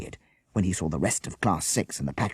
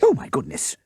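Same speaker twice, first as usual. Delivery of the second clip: gasp; 7 semitones higher, 5 dB louder than usual. Acted. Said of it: "she gasped".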